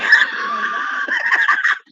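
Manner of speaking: a loud high burst of real laughter; high shrieking laugh. Real, not acted. Laughing hard while telling about the rubber chicken. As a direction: let out a shrieking cackle of laughter.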